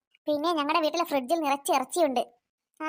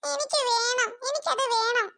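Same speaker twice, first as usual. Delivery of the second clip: mock crying kid; 7 semitones higher, slower than usual. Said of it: "ആഹാ, നീ കരയുന്നു".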